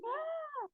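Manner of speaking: high, soft, drawn-out "ah" of mock wonder; amazed crowd. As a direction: imitate a crowd's awed "ah". Imita el asombro de la gente. ("ah" agudo de gente asombrada)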